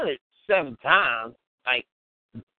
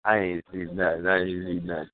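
lower-voiced mock innocence; hint of a low, innocent denial; playing dumb; 12 semitones lower than usual. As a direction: feign ignorance in a low voice. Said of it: "you still try to play dumb".

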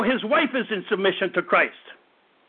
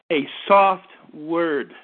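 slow, low and gentle, drawn out; soft word; 7 semitones lower, much slower than usual. Shown as a demonstration. Preacher to congregation, modelling a slow, gentle soft word. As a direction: say it softly, like calming someone. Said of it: "A gentle voice turns away wrath".

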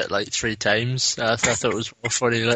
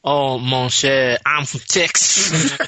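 slow, drawled fake Cajun accent, higher pitch; bad Cajun accent; 3 semitones higher, much slower than usual. Mocking the actor's dodgy Cajun accent, slow and drawled. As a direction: overdo a Cajun accent that slips into Texan. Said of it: "can't do a fucking Cajun accent".

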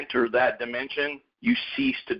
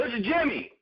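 higher, lightly voiced caller; caller introducing himself; 10 semitones higher, 4 dB quieter than usual. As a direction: say your name like a buddy on the phone. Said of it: "And they go".

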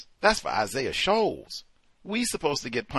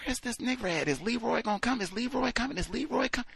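high, hushed mock chatter; soft, high-pitched questions passed along; impatient court onlookers; 8 semitones higher, 7 dB quieter, faster than usual. Court waiting on late Leroy, hushed impatient asking.

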